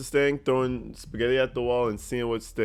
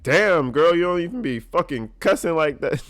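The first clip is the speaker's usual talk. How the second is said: higher and louder, teasing the detective; loud, brassy mock banter; playful cussing; 6 semitones higher, 6 dB louder than usual. Vlogger imagining cussing around the detective, loud playful teasing. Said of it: "I'd be cussing up a storm around her like".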